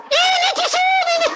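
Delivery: wife yelling that the demon is here, half laughing; wife screaming. Retold or imitated. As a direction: squeal it out like the panicked wife. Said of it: "istri saya teriak-teriak".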